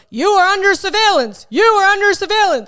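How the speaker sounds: high, loud automated security warning; surveillance system voice. Retold or imitated. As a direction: blare it out like an alarm announcement. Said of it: "this voice goes".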